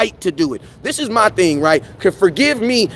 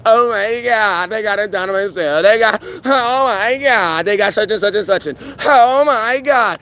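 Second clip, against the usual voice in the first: mock awed fan; 3 semitones higher than usual. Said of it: "look at your stats and be like".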